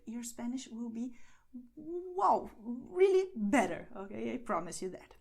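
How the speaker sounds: ordinary delivery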